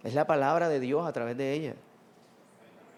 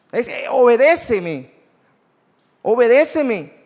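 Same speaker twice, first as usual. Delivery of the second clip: impassioned preaching; 7 semitones higher, 8 dB louder than usual. Acted. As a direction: plead each obedéceme as Christ at the door. Preaching Jesus' call to obey.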